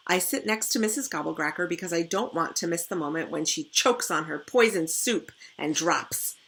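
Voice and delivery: usual speaking voice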